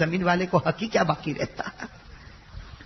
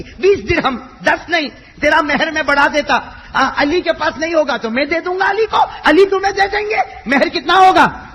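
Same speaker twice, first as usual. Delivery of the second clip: much higher and louder, pleading; rising fervour; 10 semitones higher, 10 dB louder than usual. Acted. Prophet raises his daughter's dowry, climbing in pitch and volume. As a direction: raise the offer with mounting passion.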